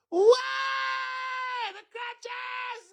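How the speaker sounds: very high wail through laughter; real hysterical high squeal; laughing shriek. Real, not acted. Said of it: "right now I'm laughing at you".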